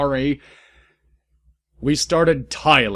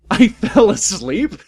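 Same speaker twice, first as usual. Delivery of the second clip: laughing through words; 10 semitones higher, 7 dB louder than usual. Real, not acted. Reader breaks up laughing at the sex scene. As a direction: read out between laughs. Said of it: "laughing while reading".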